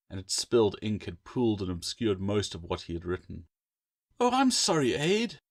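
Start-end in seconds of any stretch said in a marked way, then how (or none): 4.2-5.4 put-on much higher girlish oh I'm sorry; sweetly apologetic young woman; lightly voiced sister; sister's apology. A narrator's reading. Sister apologises for spilled ink.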